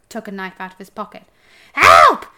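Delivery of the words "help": high, loud cry for help; shriek. A narrator's reading. Dwarf facing a knife, shrieking in terror. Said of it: "shrieked the dwarf".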